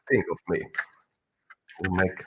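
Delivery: normal talking voice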